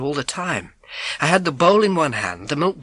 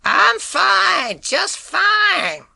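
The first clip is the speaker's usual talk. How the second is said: put-on high, slow old-lady call; in her voice; 5 dB louder, much slower than usual. Boy calling to the milkman in the old lady's voice. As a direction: put on the old woman's voice to fool him. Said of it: "in her voice, in her accent".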